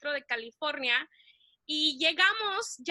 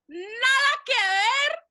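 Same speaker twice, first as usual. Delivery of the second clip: drawn-out disbelief; 8 semitones higher, 6 dB louder, much slower than usual. Retold or imitated. Stunned comparison of names.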